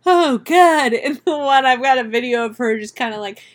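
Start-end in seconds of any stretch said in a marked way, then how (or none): 0.1-0.9 high, loud mock dismay; hammed-up high-pitched horror; horrified puppies. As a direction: voice an appalled puppy. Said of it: "they were like".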